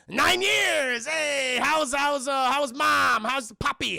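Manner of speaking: high, loud, hammed-up small talk; acted bright-eyed holler; over-cheerful greeting. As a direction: gush cheerily to cover the awkwardness. Tuco over-cheerful with his brother.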